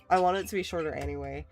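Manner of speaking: ordinary talk, nothing marked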